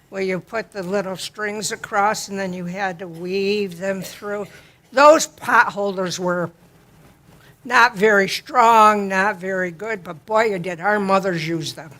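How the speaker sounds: ordinary delivery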